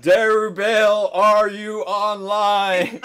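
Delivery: high, very loud, stretched-out call; booming mock announcement, words dragged out; mock loudspeaker page. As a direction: page a player across the whole server. Pretend loudspeaker call to a player.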